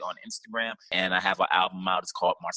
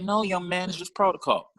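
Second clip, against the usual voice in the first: lightly voiced nurse, pitched higher; a hint of a stern, high nurse; mock nurse; 9 semitones higher than usual. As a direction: play the prim nurse, up high. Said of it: "She's like".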